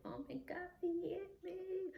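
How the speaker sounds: high, terrified cry out; panicked cry. Retold or imitated. Running from the car after he hit her, panicked high cry. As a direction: cry out high as you run away. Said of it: "running down the road like".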